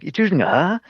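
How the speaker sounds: surprised question. Retold or imitated. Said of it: "It's like".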